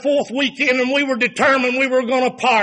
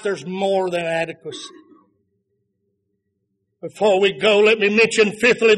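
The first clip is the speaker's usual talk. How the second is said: ordinary talk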